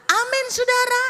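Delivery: high, loud preacher's call; fiery preaching. Real, not acted. Preacher rouses the congregation, shouting.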